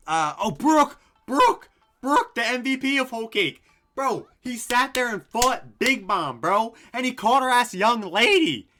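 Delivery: loud, high-pitched fan hype; excited shouting. Real, not acted. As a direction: cry out the name, then gush at full volume. Suddenly recalls favourite character, gushing.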